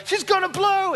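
high, hammed-up dramatic warning; action movie line. Imagined firefighter warning of the blast, high and hammed-up. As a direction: deliver it like a blockbuster hero. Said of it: "they'd say cool things like".

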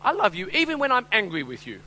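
high, loud child impression; little daughter's voice. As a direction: imitate a small girl declaring herself. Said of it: "I hear this little voice in the back".

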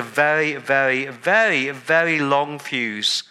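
very drawn out slowly; slow stretched very very very very; stretched repeated very. Shown as a demonstration. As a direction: draw out each very to show patience. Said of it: "stretching very".

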